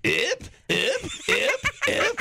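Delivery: repeated high mock-French yips; French accent. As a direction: yip like a bumped French tourist. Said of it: "they bump into you and go".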